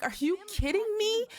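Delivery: hammed-up offended squeal; Nikki in disbelief. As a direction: play Nikki's shocked disbelief big. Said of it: "Nikki's like".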